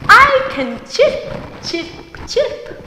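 high chirping bird voice trailing off; little bird chirps; chirpy bird. Acted. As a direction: pipe it up like a small bird.

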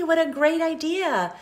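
usual speaking voice

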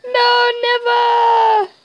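high, loud cry of protest; anguished yell. Real, not acted. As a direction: scream it as everything goes wrong. Losing the fight in game.

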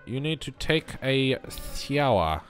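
ordinary delivery

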